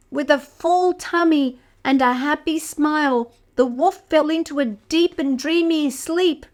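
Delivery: ordinary delivery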